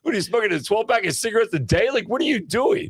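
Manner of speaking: very high-pitched mock disbelief; incredulous teasing. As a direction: retell it as a baffled dad, squeaking upward. Retold dad stunned by deep voice.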